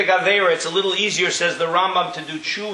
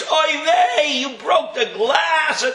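high, laughing overreaction; overexcited; 10 semitones higher, much slower than usual. Retold or imitated. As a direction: wail it like a frantic parent. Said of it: "They get all excited over every little thing".

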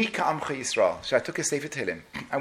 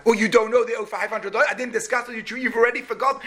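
indignant shopkeeper; 8 semitones higher, 5 dB louder than usual. Acted. Shopkeeper argues over the debt.

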